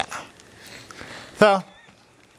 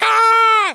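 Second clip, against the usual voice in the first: piercing high shriek, very loud; shrill scream; 7 dB louder than usual. Retold or imitated. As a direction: scream at the top of your voice. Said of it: "I was all like".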